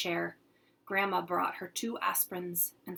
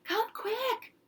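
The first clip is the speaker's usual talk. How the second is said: urgent call. Acted. Boy yelling for his mother.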